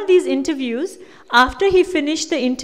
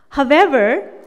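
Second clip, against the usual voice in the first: ordinary talk, nothing marked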